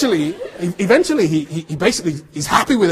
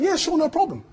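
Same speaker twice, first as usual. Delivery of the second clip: breezy; 11 semitones higher, 5 dB quieter than usual. Retold or imitated. Breezy reply to producer.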